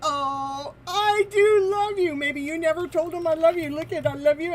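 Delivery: usual speaking voice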